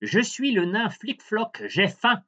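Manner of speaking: high, squeaky little dwarf; tiny dwarf voice. A narrator's reading. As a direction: pitch it up like a tiny man. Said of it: "un tout petit homme apparut et lui dit".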